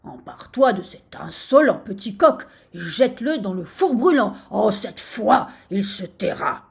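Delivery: loud, irritated royal order; commanding. A narrator's reading. Sultan orders gardener to burn the rooster, commanding. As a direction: hammer out the order forcefully. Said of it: "lui ordonna-t-il".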